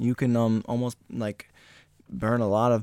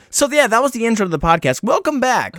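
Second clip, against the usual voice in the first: higher, bright host welcome; host intro; 8 semitones higher than usual. Acted. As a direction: greet listeners like a show host.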